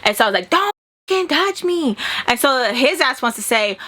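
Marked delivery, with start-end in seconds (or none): 0.5-2.0 angry. Retold or imitated. Fight, telling him off.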